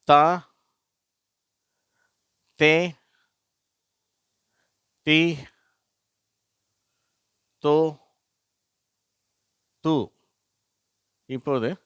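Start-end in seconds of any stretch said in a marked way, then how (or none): 0.0-10.1 தா தே தி தோ து read one by one, higher and louder with long pauses; teacher modelling syllables; syllable reading demo. Shown as a demonstration. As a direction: call out each syllable clearly for pupils to repeat. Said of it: "அடுத்த வரிசை இப்ப வாசிக்கிறேன்".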